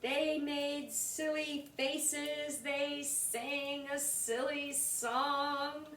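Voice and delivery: slow, lilting read; slow sing-song. A narrator's reading. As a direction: draw it out playfully. Sing-song slowed narration.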